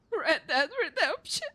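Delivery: ordinary talk, nothing marked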